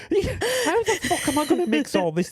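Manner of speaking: high, laughing what-the-fuck; baffled and cracking up; laughing disbelief. Retold or imitated. Mix engineer opening a messy session, laughing disbelief. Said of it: "And you're like".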